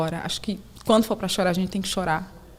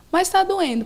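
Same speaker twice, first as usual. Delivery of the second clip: put-on child whimper; 10 semitones higher than usual. Child complains of pain.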